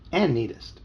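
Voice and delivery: plain reading voice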